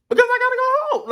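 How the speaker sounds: high-pitched, loud, fast disbelief; exasperated. Real, not acted. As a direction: squeak it out in total disbelief. Exasperated retelling of uncle's outburst.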